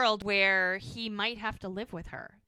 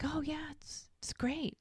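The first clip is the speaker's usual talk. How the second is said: breathy whispered mock reply; 6 semitones higher, 8 dB quieter, slower than usual. Ex answers Jack's question in a mock whisper. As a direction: whisper it like hiding the truth. She fakes being fine at work.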